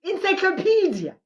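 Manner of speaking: high, loud proud "I told you"; crowing with glee, pitch way up; excited boast. Real, not acted. Boasting about her English.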